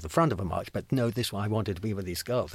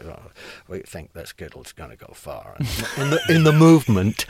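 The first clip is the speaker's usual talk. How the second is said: overheard onlooker; 10 dB louder than usual. Retold or imitated. Impersonates a man overheard nearby.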